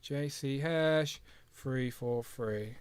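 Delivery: very slow read-back; slow repeating back. Shown as a demonstration. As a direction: repeat it slowly, writing it down. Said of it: "What is it now?".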